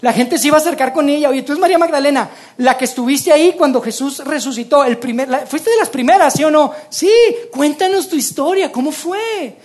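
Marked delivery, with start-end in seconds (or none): 1.3-9.6 higher, louder eager questions; curious neighbours pressing María for her story, higher and louder; eager crowd. Acted.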